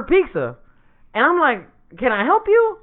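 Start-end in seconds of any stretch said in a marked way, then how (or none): 2.0-2.8 high-pitched, puzzled acted question; mock confusion at a stranger; puzzled query. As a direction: raise the pitch, acting baffled. Puzzled at an unexpected visitor.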